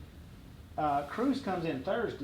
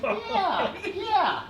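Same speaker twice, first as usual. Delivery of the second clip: high-pitched sheepish yeah; awkward agreement; 12 semitones higher than usual. Retold or imitated. Governor asks about the Trump remark, high awkward agreement. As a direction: squeak out a reluctant yes.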